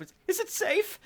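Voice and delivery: high, tearful, fearful squeak; frightened crying woman. Acted. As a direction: plead in a frightened crying voice from hiding. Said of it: "young woman crying under wagon".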